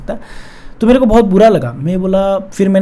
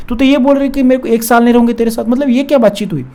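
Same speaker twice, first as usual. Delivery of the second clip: higher, pushing back in disbelief; retold heated objection, pitched up; upset protest; 5 semitones higher than usual. (ऊँची आवाज़ में हैरानी से विरोध)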